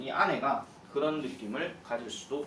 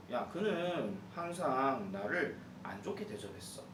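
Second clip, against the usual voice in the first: ordinary talk, nothing marked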